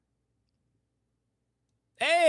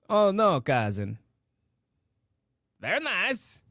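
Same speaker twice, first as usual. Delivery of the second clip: put-on hammy Borat catchphrase accent; slower than usual. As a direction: do a Borat impression, accent and all. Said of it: "talk to each other in Borat accent".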